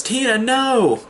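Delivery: high-pitched imitated pleading; mock desperate plea. Retold desperate plea as friend leaves.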